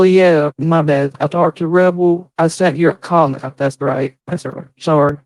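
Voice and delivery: ordinary delivery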